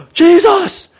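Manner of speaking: high, loud cry of his name; Mary overjoyed at seeing him; a preacher acting out Mary's delighted shout; joyful cry. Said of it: "Mary goes".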